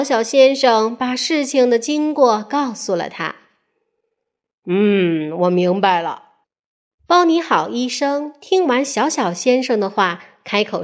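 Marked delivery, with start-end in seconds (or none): 4.7-6.3 a hint of a deep, wise doctor; a doctor understanding the story; lower, thoughtful doctor. The doctor ponders.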